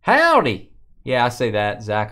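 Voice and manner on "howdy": high, loud falling "Howdy"; yelled howdy. Shown as a demonstration. Streamer greeting a viewer, yelled howdy. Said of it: "I yell howdy".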